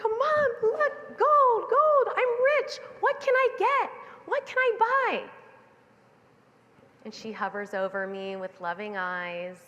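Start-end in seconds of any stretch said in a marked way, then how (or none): 0.0-5.2 put-on very high, loud child's shout; ecstatic kid yelling. Little girl calling mom down to see her gold, high and ecstatic. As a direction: yell it to mom up the hill, overjoyed. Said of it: "and she's like".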